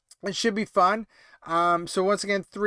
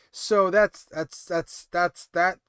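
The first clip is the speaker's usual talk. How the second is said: real tired stumble, restarting on "that's"; stuttering; slower than usual. Real, not acted. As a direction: trip over the same word several times before getting it out. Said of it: "Oh my God, I'm stuttering".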